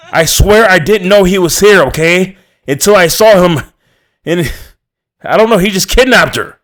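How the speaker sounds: high and loud, breaking; desperate pleading. A narrator's reading. Narrator pleads with the furious father, high and loud. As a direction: beg him to believe you.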